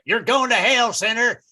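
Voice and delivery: mock fire-and-brimstone radio sermon; radio hellfire preacher. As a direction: warn sinners like a radio evangelist. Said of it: "a lot of the stations are actually owned by the same person".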